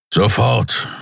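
short firm gruff word; gruff insistence. Acted. Keru insists to the crew, gruff. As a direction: clip it, no argument.

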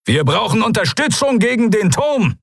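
high, strained battle call; urgent call. A narrator's reading. Calling for support against the tower, urgent high shout. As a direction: call for backup over heavy fire. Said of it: "rief er".

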